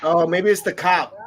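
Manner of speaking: ordinary talk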